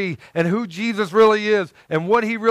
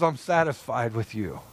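low, soft, tender; Jesus' voice; 9 semitones lower, 8 dB quieter than usual. Pretend. God speaking softly to the wretched speaker. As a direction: gently reassure a sinner. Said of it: "And yet he goes".

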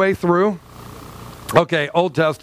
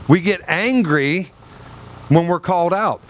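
usual speaking voice

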